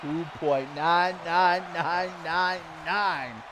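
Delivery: slow, drawn-out mock count with every nine stretched; Alaric impression. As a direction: drag out each nine like Alaric stalling the count. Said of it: "Doing my best Alaric impression".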